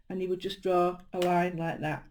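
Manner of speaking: ordinary delivery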